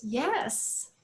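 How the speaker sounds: softly imitated reassurance; retold gentle answer; soft-voiced father. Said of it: "once again, his voice so soft".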